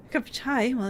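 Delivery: ordinary talk, nothing marked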